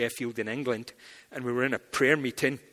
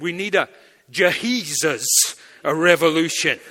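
proclaimed punchline; 8 dB louder than usual. Retold or imitated. Punchline to Marxist.

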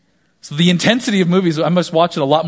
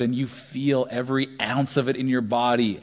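normal talking voice

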